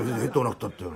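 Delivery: ordinary delivery, nothing marked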